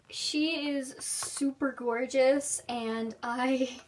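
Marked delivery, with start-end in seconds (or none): none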